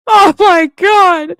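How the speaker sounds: high, slow shout mid-laugh. Real, not acted. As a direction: yell it out through laughter.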